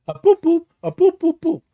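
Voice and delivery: high playful poop poop; keypad tones. Retold or imitated. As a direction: tap out a code in high chirps.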